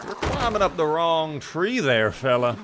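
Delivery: slow, put-on Southern drawl; Southern accent. Shown as a demonstration. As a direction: drawl a hammed-up folksy Southern warning. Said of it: "Something like that, right?".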